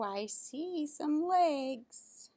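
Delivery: ordinary delivery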